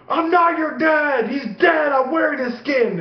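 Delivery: high shouted monster threat; alien menace. Retold or imitated. Dad pretending to be an alien to his kid, mock menace. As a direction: shout it like a hammed-up alien impostor.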